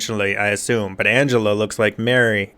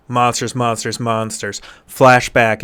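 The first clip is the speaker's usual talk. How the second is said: ordinary delivery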